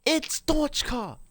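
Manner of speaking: very high, drawn-out character squeal; slow, high-pitched comic whine; squeaky mock protest. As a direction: protest your name in a high silly voice. Mock-protest over the name.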